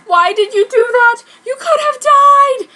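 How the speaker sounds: hammed-up crying shout. Sakura scolding bleeding Ryo, hammed-up crying shout. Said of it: "I said, crying".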